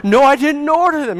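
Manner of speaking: high, loud insistence on the phone; a retold shouting match, flung back hard; arguing with the rep, high and loud; heated denial.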